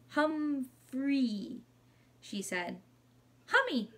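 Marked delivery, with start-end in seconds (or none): 0.1-1.6 "Humphrey" drawn out slowly in a higher voice; hesitant attempt. A narrator's reading. Frightened mom tries the hamster's English name, "Humphrey" stretched out and careful. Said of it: "looked a little frightened, but she tried". 3.5-3.9 put-on high little-boy cry; toddler's cry. A narrator's reading. Little brother races into the room, high toddler cry. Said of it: "Derek cried".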